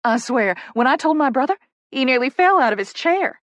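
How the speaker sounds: loud, high, excited Southern chatter; gushing Southern publicist. Acted. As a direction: gush about a family member's reaction. Poppy gushes to newcomer.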